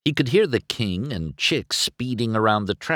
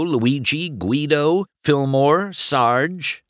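usual speaking voice

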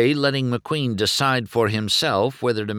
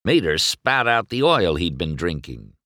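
ordinary delivery

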